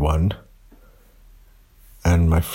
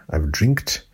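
normal talking voice

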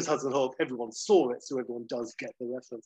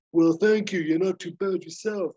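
smirking; 5 semitones higher, 6 dB louder than usual. Retold or imitated. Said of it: "and Hawk says with a smirk".

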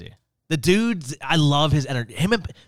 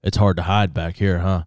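much lower, measured imitation of a TV man; Bob Pompiani; 9 semitones lower than usual. TV man chatting with the wedding DJ at the back, imitated in a low voice. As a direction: drop your pitch to mimic his deep voice. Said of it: "in his voice, he goes".

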